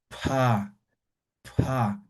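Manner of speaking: "pa" with a strong puff of breath after the p; aspirated pa. Shown as a demonstration. Teacher blowing on a sheet of paper to show the aspirated P, a breathy "pa". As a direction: blow the "pa" out so the paper moves. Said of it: "aspirando, soplando".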